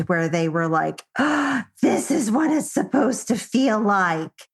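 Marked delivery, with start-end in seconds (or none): 1.2-4.5 raised, wondering quote voice; a light mock of amazed students; students' aha moment. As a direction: voice the students' sudden realisation.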